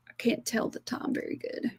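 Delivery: hushed aside to the helper, under her breath; a sheepish whispered admission. Real, not acted. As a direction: whisper it off-mic as a quick aside. Aside while checking the time.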